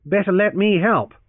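loud, falsely pleasant offer. Acted. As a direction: offer help with mock sweetness. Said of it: "he suggested pleasantly".

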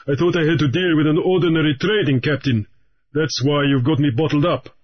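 lightly voiced, cool pirate greeting; a hint of a pirate. Raoul the pirate greets Grief, lightly voiced and cool.

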